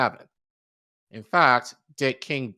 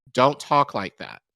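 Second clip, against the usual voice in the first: firm, louder read-out reprimand, slowed down; mildly snapped rebuke; 2 semitones higher, 8 dB louder than usual. A narrator's reading. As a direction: read the quote as a measured scolding. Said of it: "snapped at him".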